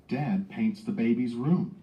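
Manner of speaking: ordinary talk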